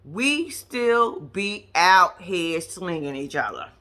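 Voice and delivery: normal talking voice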